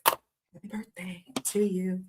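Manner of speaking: slow hushed birthday whisper. Acted. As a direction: breathe the birthday wish.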